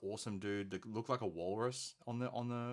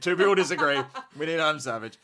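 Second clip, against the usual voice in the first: excited; 6 semitones higher, 18 dB louder than usual. Real, not acted. Excited joking declaration.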